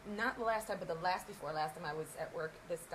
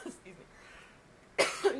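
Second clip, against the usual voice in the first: usual speaking voice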